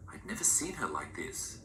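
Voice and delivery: usual speaking voice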